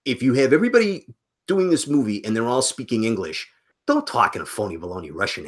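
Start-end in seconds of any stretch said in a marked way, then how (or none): none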